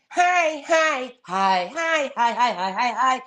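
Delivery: lightly mimicked assistant voices, pitch jumping high and back; mock chorus of varied hellos; imitated voice-menu greetings. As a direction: run through a string of sample greetings. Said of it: "scrolling through the voices, right? And they're all like".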